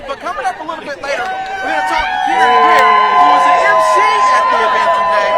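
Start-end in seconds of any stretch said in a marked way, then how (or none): 1.2-5.3 loud, raised voice over crowd; shouting over noise. Real, not acted. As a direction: shout to be heard outdoors. Reporter talking over loud event.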